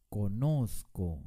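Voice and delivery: "conozco" very slow, with a long stressed NOZ; stress on penultimate syllable. Shown as a demonstration. Teacher showing correct "conozco", much emphasis on NOZ. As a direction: stretch the middle of "conozco": co-NOOOZ-co. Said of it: "con mucho énfasis en la penúltima sílaba".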